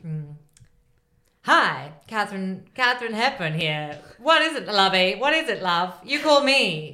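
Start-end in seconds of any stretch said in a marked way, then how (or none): 1.4-6.9 put-on old-timey voice. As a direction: ham up a vintage film star on the phone. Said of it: "old-timey voice rather than old person".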